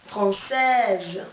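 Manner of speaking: ordinary delivery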